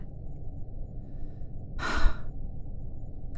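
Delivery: long, reluctant exhale; heavy sigh. Acted. Researcher about to admit getting attached, sighing. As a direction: breathe out before a hard admission.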